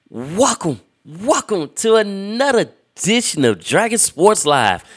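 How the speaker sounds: big high booming welcome; hype announcer. Shown as a demonstration. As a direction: belt it like a ringmaster. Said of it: "y'all gonna hear it one more time".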